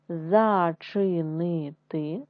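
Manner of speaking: зачинити read slowly, syllable by syllable. Shown as a demonstration. Teacher reading зачинити to first graders in syllables, slow. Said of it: "читаємо з вами слова".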